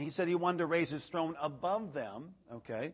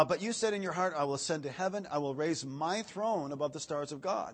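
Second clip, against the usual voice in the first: raised, declaiming pulpit reading voice; scripture reading; 2 semitones higher, 4 dB louder than usual. Acted. Preacher reading Isaiah to the congregation, declaiming. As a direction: proclaim the verse to the room.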